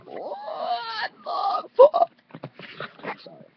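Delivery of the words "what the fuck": very high, loud, slow scream; screamo scream. Shown as a demonstration. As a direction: hold one long scream. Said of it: "like, the scream".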